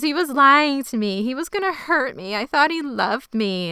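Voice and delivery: high, slow mock sob; fake crying. Shauna fake-crying to win them over, mock sobbing. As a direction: pretend to cry, hammed-up. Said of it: "it's not real crying".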